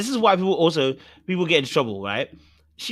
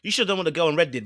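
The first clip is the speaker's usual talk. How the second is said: ordinary delivery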